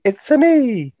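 high, drawn-out cartoon plumber catchphrase; Mario catchphrase. Fan demonstrates the voice the film needs, high Mario impression. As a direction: do the game hero's sung-out, high-pitched greeting. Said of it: "It's gotta be".

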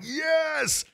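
a high, triumphant "Yes!"; excited cheer. Retold or imitated. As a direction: cheer like the home crowd. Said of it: "We're like".

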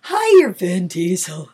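a hint of a deepened voice near a star; lightly dropped, manly voice; deep voice. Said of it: "did your voice drop, like, three octaves".

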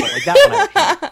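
high laughing squeal through the words. Real, not acted. Friend laughing at her own joke about the pineapple, laughing.

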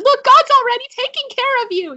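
very high, loud retold rejoicing; celebrating. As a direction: cry out the good news to the boys. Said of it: "I just start celebrating".

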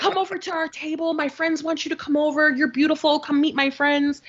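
high, insistent mock pleading; imitating pushy suitor. Man urges her to join his table, mock-insistent.

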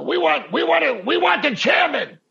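high, loud demanding shout; crowd yelling. Retold or imitated. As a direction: shout for the chairman like an impatient fan. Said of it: "they're yelling this".

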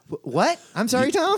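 high, baffled question; confused. Retold or imitated. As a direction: ask in laughing confusion. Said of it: "You know, like".

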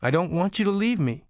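put-on high, pleading child's voice; imploring little boy. A narrator's reading. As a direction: beg your mother to stay. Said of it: "the little boy implored".

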